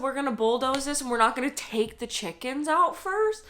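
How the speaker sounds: imitating the billionaire's men with real anger; mock indignant quote. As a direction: sneer their words back in outrage. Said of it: "They said".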